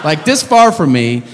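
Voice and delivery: usual speaking voice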